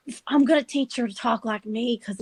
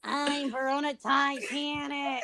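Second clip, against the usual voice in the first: mock imitation; 5 dB quieter than usual. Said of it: "That's not what I sound like".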